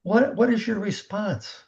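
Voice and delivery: ordinary delivery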